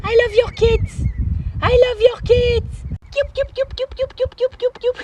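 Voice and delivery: very high, squeaky adoring voice; cooing at animals. Real, not acted. Him adoring the swans' kids, very high cooing. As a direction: gush in a tiny high voice.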